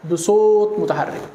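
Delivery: متحرك drawn out slowly with weight; slow stressed teaching. Shown as a demonstration. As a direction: spell out بصوت متحرك slowly for the class. (نطق بطيء ومشدد)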